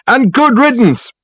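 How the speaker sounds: high, loud, drawn-out parting shout; shouted from the next room, slow and high; angry shout. A narrator's reading. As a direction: yell it after someone storming out. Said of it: "shouted a voice from the inner office".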